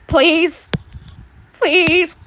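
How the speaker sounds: begging. Acted.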